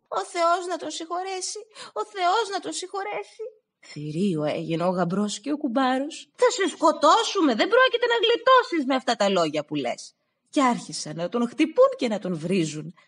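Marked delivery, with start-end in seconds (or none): 0.1-3.4 high, crying voice of condolence; sobbing condolences in a high voice; tearful wish. Acted. Stavros wishes mourners, voice crying. 6.3-10.1 loud, high furious threat; enraged groom threatening to kill; angry threat. Acted.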